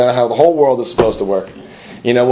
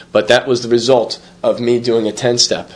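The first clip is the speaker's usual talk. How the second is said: ordinary talk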